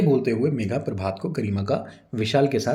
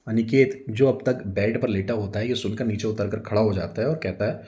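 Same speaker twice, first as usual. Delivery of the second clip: usual speaking voice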